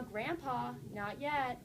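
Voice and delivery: normal talking voice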